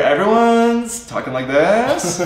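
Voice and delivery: put-on drawn-out Valley girl uptalk; Valley girl accent. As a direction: parody a Valley girl, stretching words upward. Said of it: "valley girl kind of thing".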